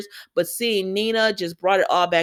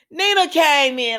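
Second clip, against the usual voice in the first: ordinary talk, nothing marked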